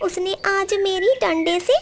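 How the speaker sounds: high, sobbing complaint; tearful parrot. Acted. Beaten parrot tells the sparrow about the crows, high and tearful.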